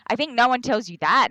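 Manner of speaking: normal talking voice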